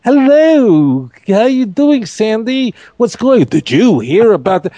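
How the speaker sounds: high, bright mock gossip chatter; mock-gossipy neighbor voice. Mocking gossiping chirpers.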